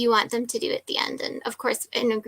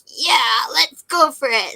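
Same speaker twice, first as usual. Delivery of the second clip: mock hyped-up; 3 semitones higher, 6 dB louder, slower than usual. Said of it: "they're like".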